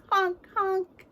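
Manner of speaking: high honking clown noise; goofy clown honk; silly clown. Pretend. Honk sound in song.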